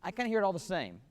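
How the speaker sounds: ordinary talk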